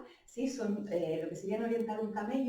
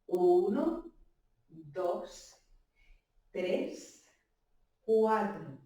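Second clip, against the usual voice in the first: slow, spaced-out dance count; 2 semitones lower, much slower than usual. Shown as a demonstration. Dance teacher takes the steps a bit slower, slow count. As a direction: count slowly, a beat between numbers. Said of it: "Lo hacemos un poquito más lento".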